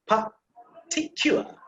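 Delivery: "Particular" slowly, part by part; syllables joined slowly. Shown as a demonstration. Teacher putting the sounds together for students, slow syllable-by-syllable "Particular". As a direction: draw out "Particular" as separate chunks. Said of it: "Now say it together".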